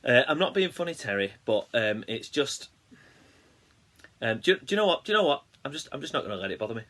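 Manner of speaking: mock huffy, with high jumps; Debbie impression. Impersonating Debbie complaining to Terry, mock huffy. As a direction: start high, then drop into dismissive resignation. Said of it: "Give us your best impression".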